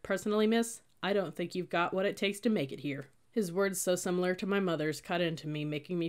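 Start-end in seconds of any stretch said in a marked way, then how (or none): none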